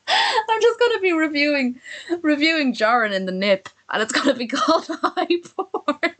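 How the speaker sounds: giggling high through the words; laughing at own joke. Real, not acted. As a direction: crack yourself up mid-sentence. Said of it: "I make myself laugh".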